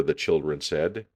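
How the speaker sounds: ordinary delivery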